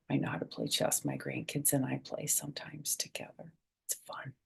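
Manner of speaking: soft whispered confiding. Real, not acted. Grandma whispering about playing chess with grandkids, hushed. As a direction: whisper it like a secret.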